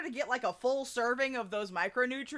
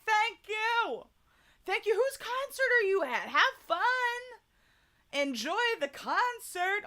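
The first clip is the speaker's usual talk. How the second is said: high, bright thanks, louder; excited thanks; 11 semitones higher, 4 dB louder than usual. Real, not acted. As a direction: gush thanks to them. Cheerful thanks to viewer.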